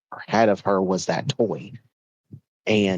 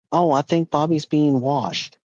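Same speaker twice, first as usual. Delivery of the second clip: higher, slow, drawn-out fib; feigned innocence; 7 semitones higher than usual. Acted. Parents covering up to their son, feigned innocence. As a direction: play it innocent and soothing.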